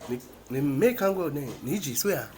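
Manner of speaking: ordinary talk, nothing marked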